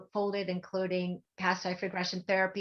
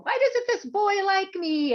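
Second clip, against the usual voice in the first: slow, high, louder whiny complaint; high whiny plea; 12 semitones higher, 6 dB louder than usual. Retold or imitated. Asking the psychic, high and whining, slow. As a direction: whine it up high like a lovesick teen. Retelling her whiny old questions.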